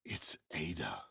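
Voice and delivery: hushed whisper over the body; shocked whisper. Acted. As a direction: breathe the name in shock. Finds the body.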